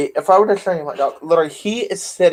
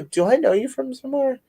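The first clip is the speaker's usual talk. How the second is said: put-on child's voice; 8 semitones higher, 4 dB quieter than usual. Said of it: "I go".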